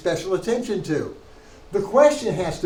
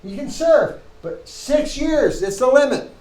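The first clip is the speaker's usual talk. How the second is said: higher, louder, insistent ruling; emphatic lawgiver; 7 semitones higher, 5 dB louder than usual. Acted. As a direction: lay down the law firmly, pitched up and loud. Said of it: "he wants to say".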